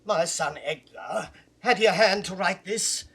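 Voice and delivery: a father's fury building to a roar; furious outburst. Acted. Gloucester's outrage at the letter.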